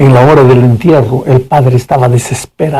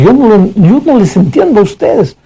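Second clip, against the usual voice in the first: aggressive father; 8 semitones higher than usual. Retold or imitated. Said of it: "le dijo a la chica agresivamente".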